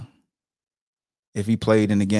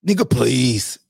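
movie villain quote; slower than usual. Retold or imitated. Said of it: "What my man Show Enough said?".

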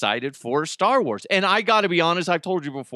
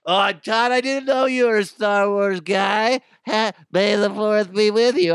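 higher, louder, delighted coworker; mock coworker glee; 6 semitones higher, 4 dB louder than usual. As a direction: gush like an office Star Wars fan. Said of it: "They're gonna be like".

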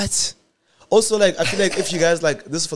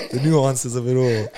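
put-on posh British accent; 3 dB louder, slower than usual. Mocks posh formal British accent.

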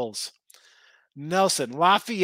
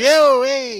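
louder and higher "Yo, hey" like a wound-up Italian; fired-up shout; 7 semitones higher, 8 dB louder than usual. Retold or imitated. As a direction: burst out with a riled-up call. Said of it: "We get a little fired up".

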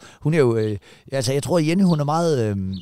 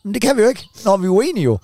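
raised protest; 7 semitones higher than usual. Retold or imitated. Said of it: "Og så".

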